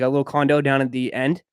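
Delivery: ordinary talk, nothing marked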